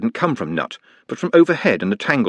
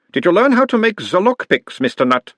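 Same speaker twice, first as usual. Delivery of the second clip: put-on higher, louder doctor's voice with a light Uberwald accent; mild Uberwald accent; 10 semitones higher, 4 dB louder than usual. A narrator's reading. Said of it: "mild Uberwaldian accent".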